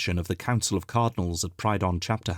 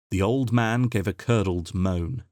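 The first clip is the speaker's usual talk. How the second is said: usual speaking voice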